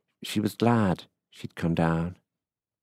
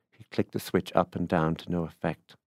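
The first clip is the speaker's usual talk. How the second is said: ordinary delivery, nothing marked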